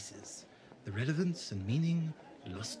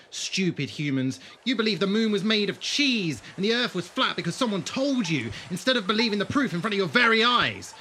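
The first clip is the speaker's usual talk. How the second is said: scornful mockery; 6 semitones higher, 7 dB louder than usual. Angry mocking.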